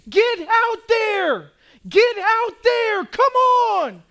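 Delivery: high, loud, slow pleading shout; imploring yell. Retold or imitated. Officer imploring frozen men under fire, loud shout. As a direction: beg them out from behind the wall. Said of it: "just imploring them".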